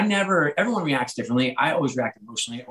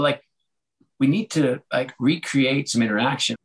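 ordinary talk, nothing marked

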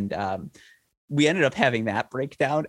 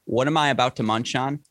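ordinary talk, nothing marked

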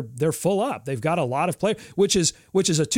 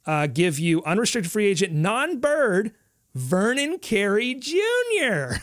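higher, hammed-up game show pitch; salesman pitch; 7 semitones higher than usual. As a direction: sell it like a showroom host. Grand reveal of the last name.

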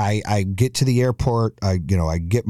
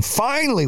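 "Finally" jumps up very high; stress on FINALLY; 14 semitones higher than usual. Real, not acted. Dad after hunting for parking, exasperated stress on "Finally". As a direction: pitch "Finally" way up, then settle. Relief at last finding parking.

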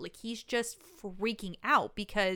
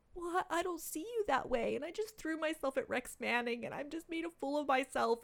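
imitating distraught girl; 6 semitones higher, 5 dB quieter than usual. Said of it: "and she's like".

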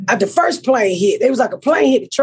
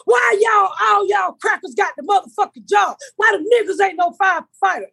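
high-pitched indignant shouting; mock angry rant; 9 semitones higher than usual. Crazy man hollering at firefighters, mock outrage. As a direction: act out a crazy man hollering his complaint.

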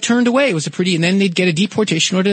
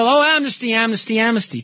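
mock yell; 5 semitones higher than usual. Republicans objecting to an immigration deal, mild mock yell. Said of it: "The Republicans yelled at each other".

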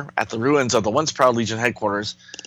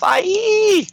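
mock scream; 4 dB louder than usual. Telepath screams in comic.

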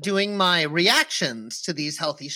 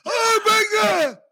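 mouth burning from spicy food; 12 semitones higher than usual. Real, not acted. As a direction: gasp as the ginger burns. Reacting to ginger shot.

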